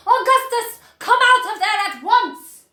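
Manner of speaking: high, loud command; shouting mother. A narrator's reading. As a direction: order him out. Mrs Gloop still yelling.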